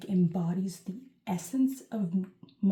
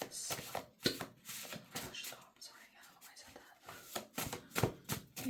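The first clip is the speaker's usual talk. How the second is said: sudden hushed whisper; 11 dB quieter, much slower than usual. Real, not acted. Vlogger stopping herself mid-reading, whispered. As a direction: breathe the words out, no voice.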